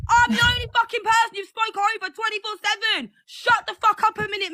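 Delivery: high, furious yell at the host; furious shout. Real, not acted. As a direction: shout down everyone talking over her. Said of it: "I'm fuming".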